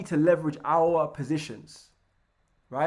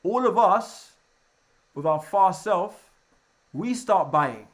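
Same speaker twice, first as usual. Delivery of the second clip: loud, slow punch on all of us; loud emphasis; 3 semitones higher, 8 dB louder, slower than usual. Real, not acted. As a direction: hammer it home to the class. Emphatic, loud teaching point.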